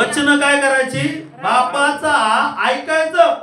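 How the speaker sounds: preacher's forceful call to the flock; emphatic preaching. Real, not acted. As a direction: drive the lesson home, loud and high. Preacher drills the congregation on the verse.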